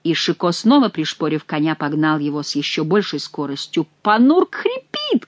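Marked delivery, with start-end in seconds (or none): 4.0-5.3 high, slow alarmed wail; alarmed cry. A narrator's reading. Monk cries to Chicot that his donkey is failing, high and slow. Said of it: "кричал Гранфло".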